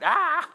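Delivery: short, high, loud "aah!"; psalmist's frustrated scream. Acted. Preacher translating half the psalm for listeners, mock anguished scream. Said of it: "let me translate".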